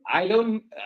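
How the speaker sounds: ordinary talk, nothing marked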